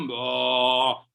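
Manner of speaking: long, low mock moo; low like a buffalo; drawn-out imitated bellow; buffalo bellow imitation. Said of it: "you see buffalo".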